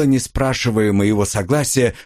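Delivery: usual speaking voice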